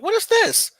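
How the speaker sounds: high, loud mock-shocked outburst; mock indignation. Friends discovering his alarm, mock indignant. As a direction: act out an affronted friend, voice jumping up. Acting out others' reaction to alarm.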